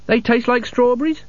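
high, surprised question. A narrator's reading. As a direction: sound amazed.

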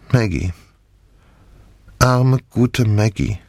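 slow, sorrowful naming of the dead; mournful. A narrator's reading. Grieving for dead Maggie.

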